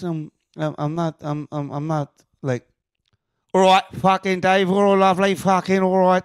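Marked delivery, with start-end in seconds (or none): 3.5-6.2 hammed-up East End London greeting; mock London accent. Shown as a demonstration. Trying an accent for co-hosts, mock London. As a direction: put on a sweary London geezer. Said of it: "I don't think I can do any accents".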